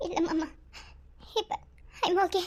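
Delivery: a drained whisper-soft reply to her uncle; weak and faint. Acted. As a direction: reassure him with no strength left. Weak girl reassures uncle.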